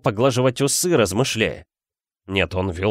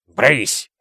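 loud. Acted. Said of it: "громко сказал мистер Дёрсли".

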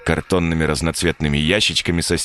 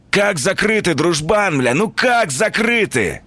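shrill indignant whine; 13 semitones higher than usual. Acted. Hoodlum bullying at door.

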